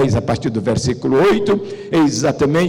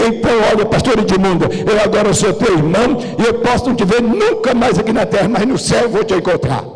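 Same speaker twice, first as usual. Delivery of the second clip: loud, higher, fast retold outpouring; fervent farewell; 5 semitones higher, 7 dB louder than usual. Converted driver tells the pastor he's now his brother, fervently. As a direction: act out a convert's rush of emotion.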